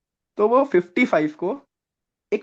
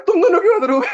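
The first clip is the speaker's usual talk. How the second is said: much higher and louder, a hammed-up officer's demand; mock stern demand; 12 semitones higher, 6 dB louder, faster than usual. GTO asks the two laughing candidates why, loud and high. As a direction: bark the question like a scolding officer, half laughing.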